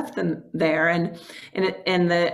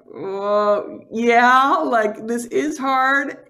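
overwhelmed reply; 3 semitones higher, 4 dB louder, much slower than usual. Retold or imitated. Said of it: "And I was just like".

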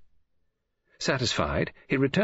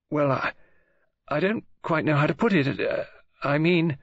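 put-on higher-pitched, halting young woman; hesitant girl; 5 semitones higher than usual. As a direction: falter as if unable to explain.

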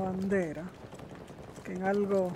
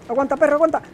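louder, higher urging; urgent call; 6 semitones higher, 7 dB louder, much faster than usual. Real, not acted. Player rushing to a downed teammate, calling loud and high. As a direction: hold on, buddy, shouted.

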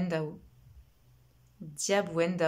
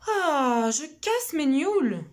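much higher, breezy defiant reply; stubborn girl; 8 semitones higher, 7 dB louder, slower than usual. Acted. Little girl brushing off her brother, carefree and stubborn. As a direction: shrug off his fear.

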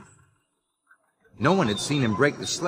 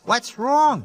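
concerned; 11 semitones higher, 4 dB louder than usual. Acted. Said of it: "Jack was upset".

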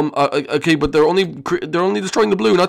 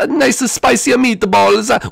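ordinary talk, nothing marked

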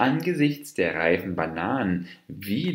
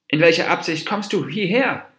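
high-pitched, indignant challenge; full of anger; 9 semitones higher, 4 dB louder than usual. A narrator's reading. Dwarf deer confronts the approaching monkey, full of anger. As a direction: demand an answer, angrily and pitched up. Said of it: "voller Zorn".

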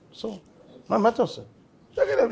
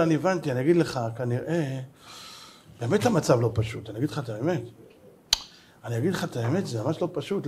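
mock solemn hush; 5 semitones lower, 8 dB quieter than usual. Rabbi feigns grave agreement.

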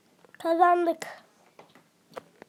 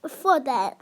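ordinary talk

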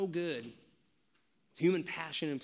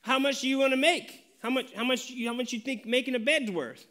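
bargaining dad; 7 semitones higher, 3 dB louder than usual. Retold or imitated. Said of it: "father bargaining with son".

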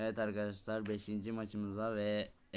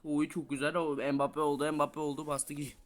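higher, louder gamer commentary; excited cheering; 5 semitones higher, 6 dB louder than usual. Real, not acted. Gamer praising an Mbappe-like move, excited cheering. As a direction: cheer the move on with raised pitch. Cheers a good play in game.

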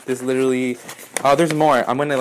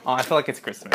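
usual speaking voice

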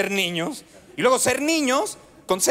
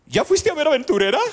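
Carmen Salinas impression; 5 semitones higher, faster than usual. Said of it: "lo primerito que te dice Carmen".

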